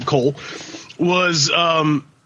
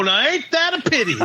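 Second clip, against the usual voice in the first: lightly voiced cowboy, pitched higher; cowboy promo catchphrase; 7 semitones higher than usual. Storyteller imitating his skit's cowboy pushing a catchphrase, lightly voiced. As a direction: toss out a wrestling-promo catchphrase, lightly played. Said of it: "starts dressing up like a cowboy".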